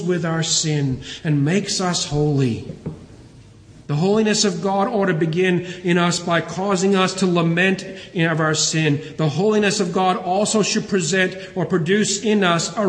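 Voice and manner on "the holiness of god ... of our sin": normal talking voice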